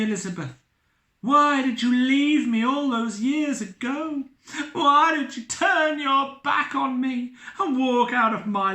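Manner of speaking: high, loud emotional pleading; impassioned plea. Acted. Captain pleading with his returned love, high and loud. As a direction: pour out your heart to her.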